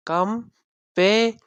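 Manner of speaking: "Compassion" started in slow, high, separated syllables. Shown as a demonstration. Teacher counting syllables for students, "Compassion" said syllable by syllable. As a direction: break "Compassion" up with long pauses. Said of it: "number of syllable three".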